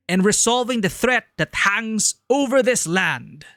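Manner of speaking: slow, grand British close; generic British accent. Shown as a demonstration. Streamer finishing the game speech in a British accent. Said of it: "voice actor voice".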